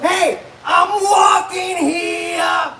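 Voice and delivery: high, very drawn-out indignant yell; brash mock shout. Comic to a paraplegic, brash indignant shout. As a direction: holler it, stretching every word.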